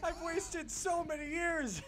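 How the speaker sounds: high, slow mock despair; mock wail. Astronaut mourning lost years, slow high mock wail. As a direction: drag it out like a hopeless whine.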